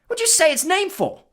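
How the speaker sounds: very high, loud alarmed scold; alarmed protest. Acted. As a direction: scold him in a fright. Stan still reeling from the name.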